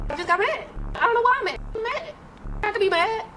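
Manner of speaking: imitating her own sudden moodiness; mock whiny. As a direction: pitch it up like a sulking kid. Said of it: "I just be like".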